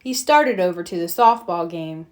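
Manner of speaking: ordinary delivery, nothing marked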